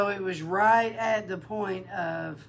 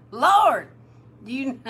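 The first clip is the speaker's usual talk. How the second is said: exasperated plea; 7 semitones higher, 11 dB louder than usual. Retold or imitated. Exasperated quote to God.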